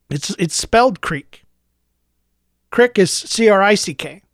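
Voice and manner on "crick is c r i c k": ordinary talk, nothing marked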